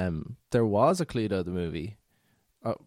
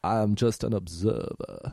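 slow, drawn-out film detective impression; attempted movie-detective accent; Daniel Craig; slower than usual. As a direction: stretch the words in a put-on character accent. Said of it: "That's how he speaks".

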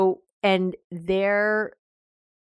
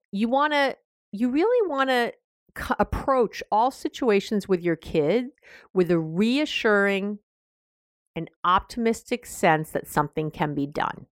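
usual speaking voice

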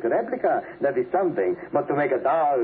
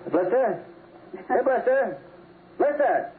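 calling dog; 11 semitones higher, slower than usual. Acted. Father calling missing dog.

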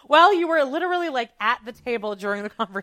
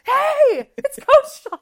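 high, loud gleeful holler; over-excited greeting; 7 dB louder, much slower than usual. Retold or imitated. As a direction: squeal your buddy's arrival to the room. Acts Seth's over-excited greeting.

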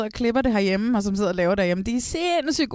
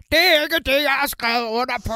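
imitating an angry shout, higher and louder at the start; mock yell; 3 semitones higher, 3 dB louder than usual. Retelling grumpy Søren yelling at the producers, mock shout. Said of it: "man kan høre Sure Søren råbe".

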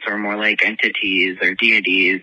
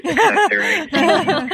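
playful mock correction; slower than usual. Real, not acted. Self-correcting word choice.

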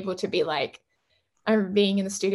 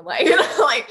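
talking through a laugh, rising higher and louder; 5 semitones higher, 8 dB louder than usual. Real, not acted. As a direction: break into real laughter while talking. Laughing over asking the guest.

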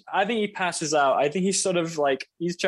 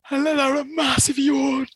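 excited exclamation; 9 semitones higher than usual. Acted. Excited shout.

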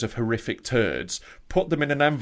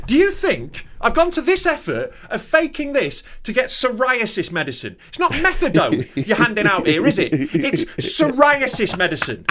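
high, incredulous ranting; retelling how he lost it at the pharmacist, a high outraged rant; exasperated rant; 11 semitones higher, 3 dB louder than usual. Retold or imitated. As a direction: pile on the disbelief, voice pitched way up. Retold argument with pharmacist.

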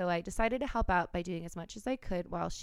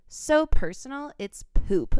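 mock dramatic header; 5 semitones higher, 9 dB louder, slower than usual. Reading the email header aloud.

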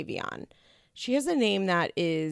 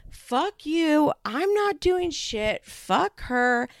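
higher, slow hammed-up yell; mock furious mom; 9 semitones higher, much slower than usual. Grandmother refusing Thanksgiving invite, mock furious yell. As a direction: rant like an enraged grandmother. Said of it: "the mom goes the fuck off".

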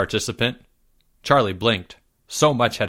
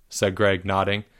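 ordinary delivery

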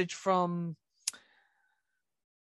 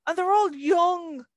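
higher and louder, pushing the words out; emphatic exclamation; 8 semitones higher, 5 dB louder than usual. Real, not acted. Telling her co-host of young soldiers, a near shout. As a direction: cry it out in disbelief.

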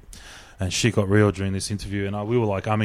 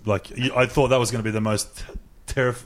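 normal talking voice

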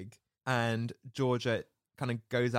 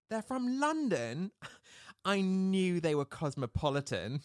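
mock-impressed girl, higher-pitched; awestruck Georgia; 6 semitones higher than usual. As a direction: imitate a wowed teenager, laughing. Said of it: "remember when she's like".

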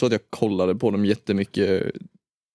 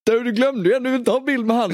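high mock voice of a pal calling after; imitating Simon; 13 semitones higher than usual. Friend on the train calling out, high mock voice. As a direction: pitch it way up, teasing. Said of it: "så säger ju Simon".

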